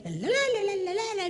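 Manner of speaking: wife's la-la chatter. Retold or imitated. Wife further away.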